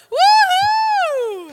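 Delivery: loud, high whoop of joy; excited cheer. Real, not acted. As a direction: cheer them on like a fan. Cheering students finishing paper.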